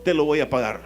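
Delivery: loud, high preaching; fervent preaching. Acted. Preacher crying out to the congregation, full force. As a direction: cry it out to the church.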